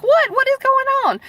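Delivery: high-pitched startled questioning; alarmed disbelief. Retold or imitated. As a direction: voice leaps way up in shock. Retelling her alarmed reply.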